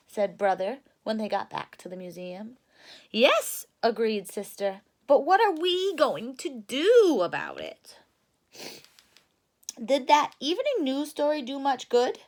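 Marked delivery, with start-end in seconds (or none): 3.1-3.7 put-on bright young Sister cub; a hint of a high cub voice; eager little sister bear, higher and louder. Sister agrees. 5.1-12.2 put-on bright young Sister cub; a hint of a high cub voice; eager little sister bear, higher and louder. Cubs' quoted lines.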